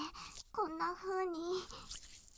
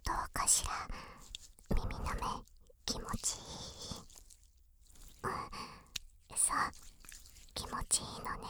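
soft unvoiced whisper; whispering in your ear; 6 dB quieter, faster than usual.

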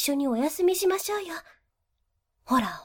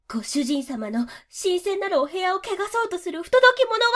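ordinary talk, nothing marked

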